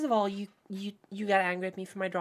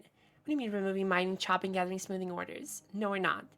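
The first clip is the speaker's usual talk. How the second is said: normal talking voice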